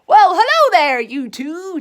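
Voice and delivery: high, loud cheery greeting; Tigger calling out. A narrator's reading. Tigger greeting Pooh and Piglet, loud cheery call. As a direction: call out like a bouncy tiger. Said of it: "Tigger called".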